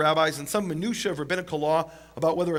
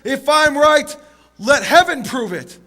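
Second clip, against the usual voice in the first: much louder, higher, slow on the end; calling out to heaven; 8 semitones higher, 9 dB louder, slower than usual. Retold or imitated. Rabbi in utter desperation calling on heaven, loud. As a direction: cry it up to the sky. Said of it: "he calls out".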